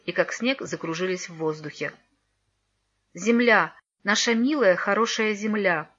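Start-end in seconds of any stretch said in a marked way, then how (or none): none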